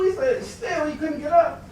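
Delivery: ordinary delivery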